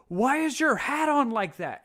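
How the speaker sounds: loud, high, drawn-out mock shout; imitated yelling chief. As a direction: bellow it like an outraged chief. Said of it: "this chief yelling at me".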